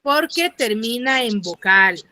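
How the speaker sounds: slow it down so every student gets it; teacher driving the rule home to students; slow emphatic restating. Shown as a demonstration. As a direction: spell out each word slowly. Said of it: "teacher restating answer slowly".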